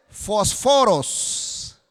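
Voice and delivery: "fósforos" slowly, stressed on the second o; Greek pronunciation. Shown as a demonstration. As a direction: say "fósforos" as Greek fosFÓros, drawn out. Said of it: "La palabra griega para lucero es". (pronunciación griega, "fósforos" con acento en la segunda o)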